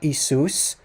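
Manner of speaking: "Isus" said slowly as ee-SOOS; Greek pronunciation. Shown as a demonstration. Teacher shows listeners the form not used, Greek pronunciation. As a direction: give "Isus" a plain s in the middle. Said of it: "the way that it's pronounced in Greek".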